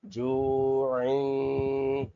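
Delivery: जूइन held long with a nasal hum; slow nasalised जूइन; nasal ghunna. Shown as a demonstration. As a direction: draw out जूइन slowly through the nose. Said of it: "तनवीन में गुना करेंगे".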